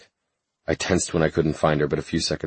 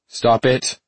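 louder, sharp call; raised voice; 7 semitones higher, 4 dB louder than usual. Acted. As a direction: raise your voice to stop her. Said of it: "I raised my voice".

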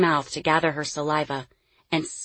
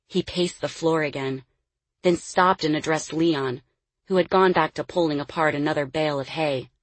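plain reading voice

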